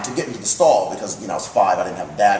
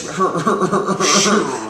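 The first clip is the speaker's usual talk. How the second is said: higher, rattling imitated chuckle; Betty; 4 semitones higher than usual. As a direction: do the godmother's long stuttering laugh. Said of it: "She laughed like this".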